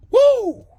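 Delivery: high, loud "woo" of relief; celebratory whoop. Retold or imitated. Applicant celebrating passed check, loud high whoop. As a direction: let out a big high whoop.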